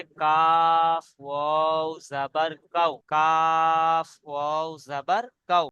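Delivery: কাফ stretched out very slowly; slow spelling. Shown as a demonstration. Teacher spelling out slowly for the class. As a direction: draw out the letter name. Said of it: "teacher spells slowly".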